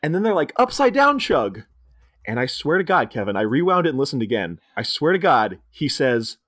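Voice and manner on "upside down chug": mock guide quote. Quoting the German guide.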